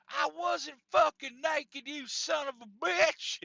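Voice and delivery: loud, high, drawn-out indignant protest; Sauna Santa ranting. Retold or imitated. Sauna Santa cursing his accuser, imitated ranting. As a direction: act out an outraged man's rant. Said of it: "Sauna Santa was ranting and raving".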